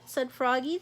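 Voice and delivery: ordinary delivery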